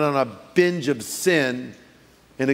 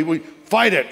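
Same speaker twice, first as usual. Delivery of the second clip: usual speaking voice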